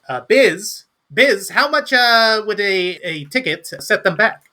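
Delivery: high, excited gnome voice; Fizz. Acted. Calling to the barkeep for a ticket price, high gnome voice. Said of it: "Fizz goes".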